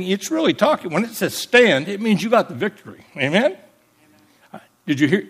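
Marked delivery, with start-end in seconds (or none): none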